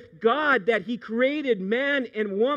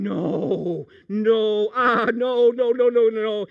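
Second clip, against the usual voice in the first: rising loud repeated no; a growing, alarmed string of noes; mock-horrified denial building louder; 6 dB louder, slower than usual. Pastor reacting to scam story.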